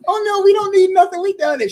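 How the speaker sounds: imitating a woman trying to hold it together; flustered girlfriend. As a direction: act her out, high and hurried to refuse. Acts out girlfriend flustered at the table.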